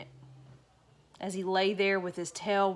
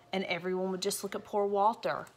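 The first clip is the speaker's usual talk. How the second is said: ordinary delivery, nothing marked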